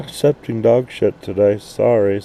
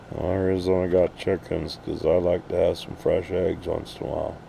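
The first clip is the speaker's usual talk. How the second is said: normal talking voice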